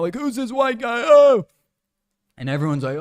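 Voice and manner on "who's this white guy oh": high-pitched excited dog voice, ending in a loud yelp; dogs freaking out. Retold or imitated. Village dogs reacting to a passing stranger, high freaked-out mock voice. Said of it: "the dogs were freaking out".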